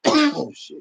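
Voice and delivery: high sudden oh, shit; a yelp after coughing; startled exclamation. Real, not acted. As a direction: caught off guard by the cough.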